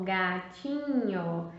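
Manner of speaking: "gatinho" said very slowly; drawn out slowly. Shown as a demonstration. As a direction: stretch out every syllable of "gatinho". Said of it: "Então, ó".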